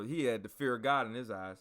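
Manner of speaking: usual speaking voice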